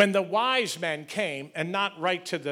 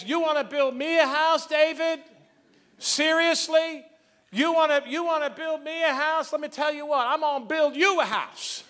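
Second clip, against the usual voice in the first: sarcastic God; 10 semitones higher, 4 dB louder than usual. Retold or imitated. God teasing David about building him a house, sarcastic and high. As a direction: scoff at the idea, then turn it around.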